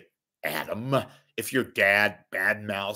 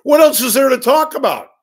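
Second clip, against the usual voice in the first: much higher, yelling out the question; loud exasperated shout; 12 dB louder than usual. Real, not acted. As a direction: blast it at the listeners. Ranting at listeners.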